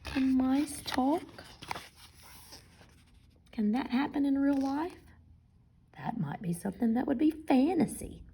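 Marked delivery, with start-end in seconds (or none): 6.0-8.2 whispering. Real, not acted. Teacher whispers.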